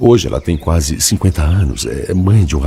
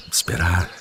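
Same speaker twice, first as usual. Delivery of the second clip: the repeated word drops away much softer; fading resigned echo; 8 dB quieter than usual. Acted. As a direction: let esperar die away to almost nothing. Echoing his own resigned word.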